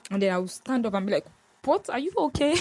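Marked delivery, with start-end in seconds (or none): 1.6-2.6 high, loud burst of worry; alarmed concern. Retold or imitated. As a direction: jump up and ask in alarm. Alarmed at caller's trouble.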